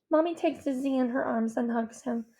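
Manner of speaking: ordinary delivery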